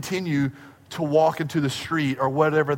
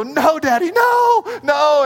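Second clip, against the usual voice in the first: put-on high, loud childish "no"; shrieking child plea; 10 semitones higher, 5 dB louder, slower than usual. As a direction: shriek like a scared little girl. [name] pleading, shout heard.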